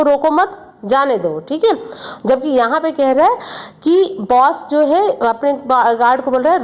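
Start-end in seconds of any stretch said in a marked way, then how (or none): 0.0-1.4 रोको मत as one unit, break, जाने दो; pause after मत. Shown as a demonstration. Boss tells peon to let him go, pause after मत. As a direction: keep मत with रोको, pausing before जाने दो. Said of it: "बॉस जो है अपने इस प्यून से कहता है".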